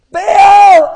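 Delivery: high full-force yell. Acted. Prophets still waiting on Baal, shouting harder. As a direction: bellow the god's name. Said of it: "started getting a wee bit louder".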